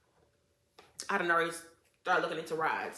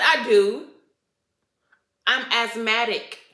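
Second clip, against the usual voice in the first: indignant outburst; 3 semitones higher, 9 dB louder, slower than usual. Retold or imitated. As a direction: make her know you're asthmatic, retelling it. Retold confrontation over smoke.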